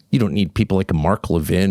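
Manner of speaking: ordinary delivery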